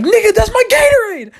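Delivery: very high, loud outraged shriek. Retold or imitated. Son shrieking at mom for giving away his Gatorade. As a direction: scream it in disbelief.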